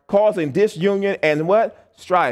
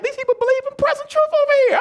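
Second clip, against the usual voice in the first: much higher and fast, in astonished wonder; amazed; faster than usual. Retold or imitated. Preacher recalls his shock at Nigerian believers, high and amazed. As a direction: gush it like you can't believe your ears. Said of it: "I'm like".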